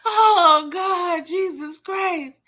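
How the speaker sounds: high gasped oh God, slowing on Jesus Christ; real disbelief, drawn out; shocked exclamation. Real, not acted. Reacting to the episode for viewers, shocked.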